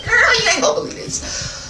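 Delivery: put-on very high excited "Girl"; high gossip voice. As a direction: squeal it to hook the listener. Teaser opening in gossip tone.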